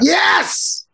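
sudden high cheer of triumph; a quick high victory yelp; triumphant yes. Real, not acted. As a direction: celebrate getting the name right. Celebrates saying name right.

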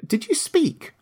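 put-on high-pitched girl's question in wonder. A narrator's reading. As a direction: ask it high, amazed. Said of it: "asked the girl in wonder".

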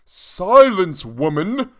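loud high count's roar; furious aristocratic roar. A narrator's reading. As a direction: roar her down. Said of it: "roared the count".

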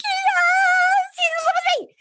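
very high, tearful-sounding squeal; hammed-up lovestruck wail, way up high; gushing mock squeal. Smitten woman telling the host about her man, mock squeal.

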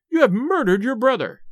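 mildly acted anguished accusation; horrified cry. A narrator's reading. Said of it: "cried Madame Charpentier".

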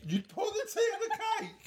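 high squeaky incredulous repeat with a laugh; mock disbelief. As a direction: echo it back in shocked mockery.

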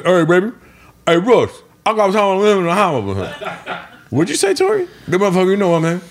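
a big friend's excited high-pitched imitation; imitating Tory. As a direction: pitch right up and boom it out like Tory. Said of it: "That's actually how Tory talks".

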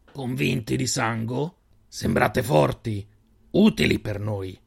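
slow, guarded stranger probing; a lightly voiced suspicious desert man; wary stranger. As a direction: size up the strangers slowly. Stranger weighs recruiting them.

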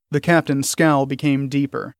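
ordinary delivery

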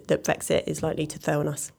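ordinary talk, nothing marked

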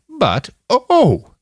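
high, loud startled "oh". A narrator's reading. Nanayazi stops mid-sentence at the screens, startled. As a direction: break off with a sudden high cry.

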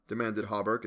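ordinary delivery

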